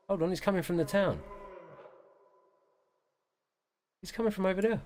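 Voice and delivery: high startled call; sudden surprise, pitch up; alarmed. Real, not acted. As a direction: hunter startled by the sound. Tracking a confusing sound.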